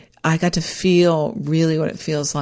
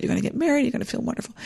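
higher but sinking softer and softer; fading self-reassurance; 6 semitones higher, 8 dB quieter, much faster than usual. Retold or imitated. Bride calming her nerves, fading to a hush. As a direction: soothe yourself until it's nearly silent. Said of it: "And then I was like".

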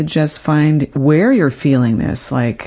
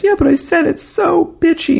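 much higher, whining mock protest; whiny protest. To her therapist, defending herself in a high mock whine. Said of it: "And then I said".

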